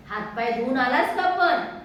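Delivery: a mother checking on her son; mother asks if he washed first; mother's question. A narrator's reading. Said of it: "आईने विचारले".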